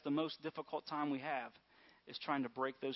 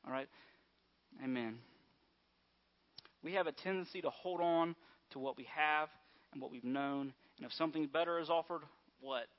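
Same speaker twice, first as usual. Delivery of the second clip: usual speaking voice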